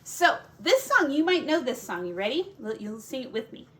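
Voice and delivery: plain reading voice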